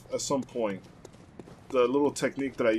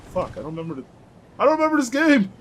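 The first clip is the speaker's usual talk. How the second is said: exasperated cry, rising to a shout; frustrated outburst; 12 semitones higher, 8 dB louder, faster than usual. Real, not acted. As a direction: yell it out in sudden panic. Panicked gamer forgets route.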